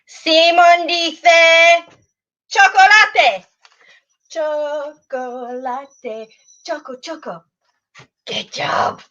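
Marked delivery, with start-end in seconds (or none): 0.1-3.4 slow, louder game call. Shown as a demonstration. Teacher calling out Simon says game. 4.3-7.4 slow, much softer chant; soft demo. Shown as a demonstration. Demonstrating action word softly.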